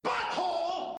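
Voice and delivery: high squeaky shouted Butthole; silly catchphrase. Pretend. Podcast host yells the running gag in a squeaky voice. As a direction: blurt it in a squeaky cartoon voice.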